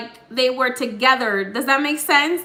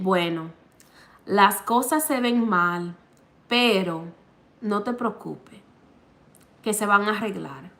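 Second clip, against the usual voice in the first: lower, measured with pauses between phrases; relayed man's voice; 5 semitones lower than usual. Retold or imitated. Medium relaying Hector's words to the client, low and measured. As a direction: pass on his reassurance in his own manner, pitched down. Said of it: "the same way he's saying it to me".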